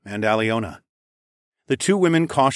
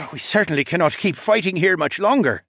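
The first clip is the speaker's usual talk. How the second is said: put-on higher-pitched, groaning old man's voice; aching old father; 7 semitones higher than usual. A narrator's reading. Sore father protests to his son, groaning. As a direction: complain like an old man with a sore back. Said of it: "rubbed his back and groaned".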